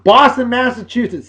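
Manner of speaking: normal talking voice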